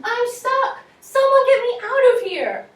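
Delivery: put-on teacher performing a trapped kid's shout; frantic shout. Shown as a demonstration. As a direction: yell for rescue, desperate. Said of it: "we're gonna be loud ... kind of, um, frantic".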